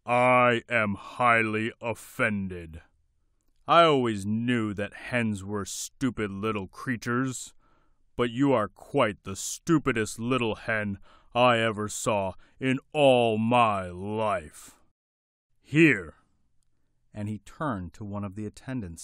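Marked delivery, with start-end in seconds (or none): 0.1-14.8 gruff booming king. Acted. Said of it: "biggest, deepest, gruffest voice". 15.6-16.2 loud command. Acted. King commands attendant.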